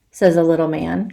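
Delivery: ordinary delivery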